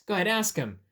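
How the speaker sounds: ordinary delivery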